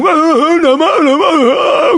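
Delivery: very high mock bawling; hammed-up toddler tantrum wail; bawling child. Child rolling on the floor crying harder, mock bawl. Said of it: "mencoba menangis lebih keras".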